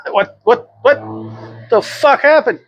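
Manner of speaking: high-pitched stammering disbelief; mock shock. Himself seeing his reflection one morning, mock shock. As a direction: play stunned, pitch leaping up.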